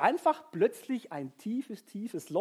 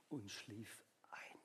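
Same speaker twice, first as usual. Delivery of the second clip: slow, low whisper; 10 semitones lower, 22 dB quieter, much slower than usual. A narrator's reading. Narrator tells how Elijah falls asleep, whispering slowly. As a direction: let it fade into sleep.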